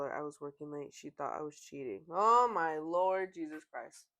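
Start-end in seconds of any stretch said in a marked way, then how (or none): 2.1-4.1 loud, slow, drawn-out exclamation; appalled, louder and dragged out; exasperated. Real, not acted. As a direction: stretch the words out in disbelief. Reads exasperated exclamation in the post.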